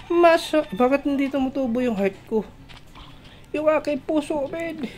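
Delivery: high-pitched comic wail; mock whining. Player lamenting hearts that won't regenerate, mock whining.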